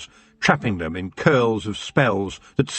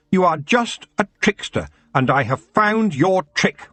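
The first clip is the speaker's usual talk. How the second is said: mild narrated shout, pitch raised; 7 semitones higher than usual. A narrator's reading. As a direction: call out the accusation, not full volume. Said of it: "he shouted".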